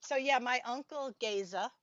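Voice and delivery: ordinary talk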